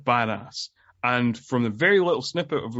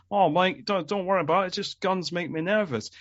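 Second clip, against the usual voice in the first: put-on lightly done Aussie accent, pitched higher; a breezy, higher imitation of an Australian thief; 7 semitones higher than usual. Host retelling the film's villain calming Mike, light Aussie accent. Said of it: "in his Aussie accent".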